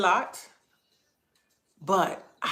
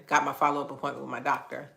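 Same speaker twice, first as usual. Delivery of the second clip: usual speaking voice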